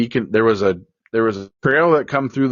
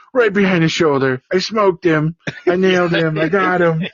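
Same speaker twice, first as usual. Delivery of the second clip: higher, eager imitation of the boy bragging; thrilled boy; 7 semitones higher than usual. Son bragging to his dad about the shot, high excited voice. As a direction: rattle it off like a proud kid. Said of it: "he's just out of his mind".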